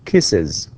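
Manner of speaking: "kisses" with a clear iz ending; "kisses" said slowly, ending on a voiced iz. Shown as a demonstration. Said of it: "we say".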